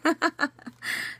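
real high giggle; laughing. Real, not acted. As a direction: let a high laugh spill out. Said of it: "why I'm laughing so much".

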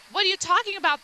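high-pitched acted protest; indignant challenge. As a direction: throw it back, mock indignant. Retold argument with doctor.

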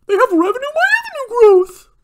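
very high, loud squealed parody; mock excited fanboy. Critic parodies a hyped investor, loud high mock squeal. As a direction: squeal it in an exaggerated put-on voice. Mocks the stock picker's excitement.

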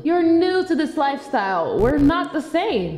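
put-on loud, haughty mean-girl voice; snobby mean friend. Snobby friend brushing off her old friend, loud and haughty. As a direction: look down on her, loudly.

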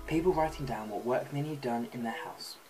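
ordinary talk, nothing marked

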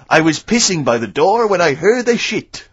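slow, hammed-up French accent from Allo Allo; comic French accent. Radio host quoting the Allo Allo policeman in a hammed-up French accent. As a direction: play the fake French policeman in a thick French accent. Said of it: "I remember there was one where he said".

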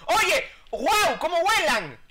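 high, loud startled cry at fast squids; sudden high shout of alarm; startled yelp. Real, not acted. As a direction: yelp in real surprise as they fly. Squid enemies suddenly leap up.

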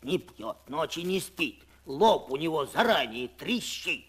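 plain reading voice

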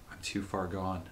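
soft, hopeless resignation; tragic heroine sorrow. Acted. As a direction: let the words fall in despair. Resigned to her fate.

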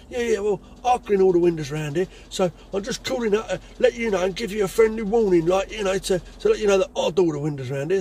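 high, loud bullish phone warning; bullying warning. Retold or imitated. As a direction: throw your weight around. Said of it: "give it the big un".

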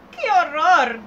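put-on old woman horrified by the baby; disgusted old woman. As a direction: recoil like a fussy old lady.

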